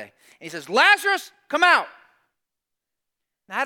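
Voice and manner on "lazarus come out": high, loud shout of summons; dramatic raised-voice command; commanding call. Retold or imitated. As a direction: call the dead man out of the tomb. Jesus calls into the tomb.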